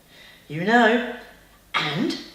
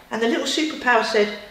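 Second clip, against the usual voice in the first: ordinary delivery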